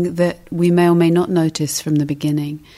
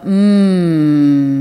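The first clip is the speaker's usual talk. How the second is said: drawn-out demonstration hum sliding down; humming "mm"; 7 dB louder than usual. Shown as a demonstration. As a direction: show a long "mm" on the out-breath. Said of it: "kind of sound".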